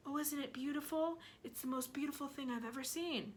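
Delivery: soft murmur to himself; boy murmuring softly in awe; quiet murmur. Acted. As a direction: breathe it in wonder. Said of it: "murmur quietly to himself".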